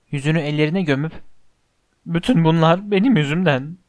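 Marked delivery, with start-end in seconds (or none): none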